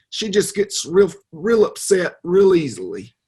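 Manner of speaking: high-pitched, flustered Southern lady who drops her R's; Dora Lee Gaithers. Shown as a demonstration. Prankster demonstrates his character for the interviewer, high flustered Southern lady. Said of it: "what does she sound like?".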